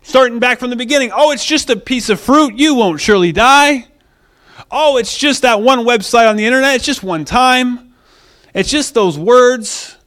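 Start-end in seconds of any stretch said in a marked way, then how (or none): 1.1-10.0 high, loud, dismissive reassurance; acted-out devil downplaying it; minimizing. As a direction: brush it off in a raised, tempting voice. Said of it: "He likes to minimize your sin".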